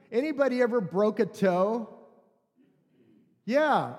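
ordinary delivery

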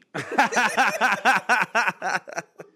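loud, high staccato laugh; big pulsing cackle. Acted. Cackles after the hillbilly joke.

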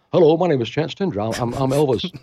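a hint of [name] greeting at the door; [name] guy. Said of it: "this [name] guy that goes".

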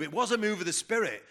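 ordinary talk

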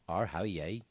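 low, quiet attempt at a Belfast accent; soft, unsure Belfast impression. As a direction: try on a Northern Irish accent. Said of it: "more brushing up on the accent".

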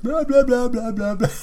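high, loud mock tantrum babble; whiny kid. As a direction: mimic a kid flailing and whining on the floor. Kid kicking on the floor.

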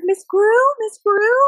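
high, loud mock-panicked call. Friend runs inside for the mom, panicked calling. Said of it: "like, panic voice".

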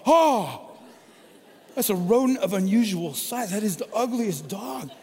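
high, loud "Oh, that's a rodent of unusual size"; shocked exclamation. Retold or imitated. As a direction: blurt it out in mock horror, laughing at the end. Shocked at the ugly dog.

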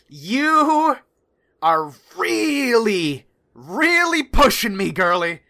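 high, loud exasperated rant, slowing into stretched words; rage, then strain to hold it back; pissed off. Acted. Howland threatens the woman he is shaking, furious and exasperated. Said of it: "he's pissed".

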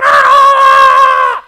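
high loud mad scream; mock scream. Naked demoniac on the beach, loud high scream. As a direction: shriek like the madman.

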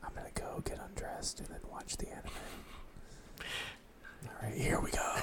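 soft playful ASMR whisper; giggly prank whisper. Pretend. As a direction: whisper close to the mic, giggling. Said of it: "let's whisper into the mic".

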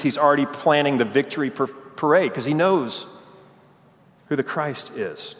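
normal talking voice

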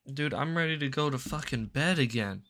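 normal talking voice

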